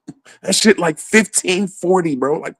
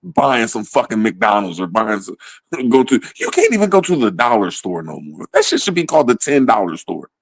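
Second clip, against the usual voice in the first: mock weary shopper; 7 semitones lower than usual.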